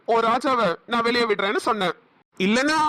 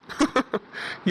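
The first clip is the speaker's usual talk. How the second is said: breathy gloat laugh; 4 dB quieter than usual. Acted. Villain gloats.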